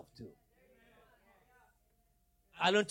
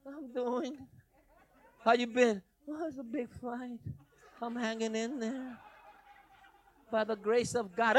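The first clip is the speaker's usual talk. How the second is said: mock weary churchgoer; 5 semitones higher than usual. Pastor mocking a self-pitying church member, sighing. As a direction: sound sorry for yourself.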